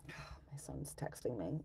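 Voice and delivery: unvoiced whispered aside, slow; real whispered aside. Real, not acted. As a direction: drop to a breathy whisper. Aside while live streaming.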